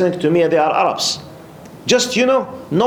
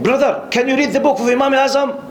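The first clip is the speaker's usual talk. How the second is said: much higher voice, insistent quizzing; challenging questioner; 10 semitones higher than usual. Retold or imitated. Man in the mosque tests the newcomer, challenging. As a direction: imitate an earnest brother testing someone, pitched right up. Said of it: "He was asking me in this attitude".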